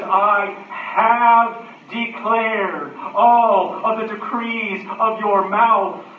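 high, drawn-out declamation; loud, noisy verse. Acted. Preacher quotes the poet's track record, loud and noisy. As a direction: proclaim it slowly at full volume. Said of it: "how loud and noisy the verse is".

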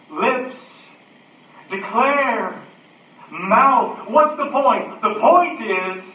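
high, loud single-word proclamations; emphatic preaching. Real, not acted. Preacher picks out the verse's noisy words, emphatic preaching. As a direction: punch out each word at high pitch.

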